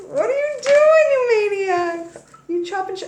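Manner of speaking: high squealed scold at the Virgos; mock scolding squeal. Tarot reader teasing her Virgo viewers, high mock squeal. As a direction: shriek it like a shocked auntie.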